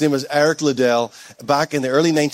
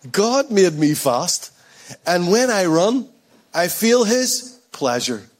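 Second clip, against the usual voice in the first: very slow, lifted and deliberate; measured, heartfelt emphasis; slow, savored quote; 2 semitones higher, slower than usual. Acted. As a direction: stretch each phrase with pauses between. Repeats runner's motto for effect.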